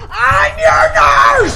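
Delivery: a new psych nurse shouts back to her patient, full yell. Retold or imitated. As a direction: belt it out down the hall so he hears. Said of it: "and I'm yelling".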